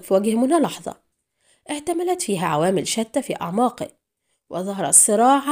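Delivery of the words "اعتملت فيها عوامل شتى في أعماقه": ordinary delivery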